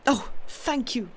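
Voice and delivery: a slow, heartfelt exclamation, lightly voiced; a touch of grateful outcry, drawn out; cried out. A narrator's reading. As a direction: let the thanks spill out slowly, mildly overcome. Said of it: "I cried out".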